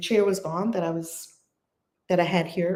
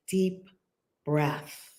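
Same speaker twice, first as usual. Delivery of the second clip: hushed prayerful chant of peace; guided prayer; slower than usual. Acted. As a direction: lead them into calm, slowly with long pauses. Leads prayer meditation.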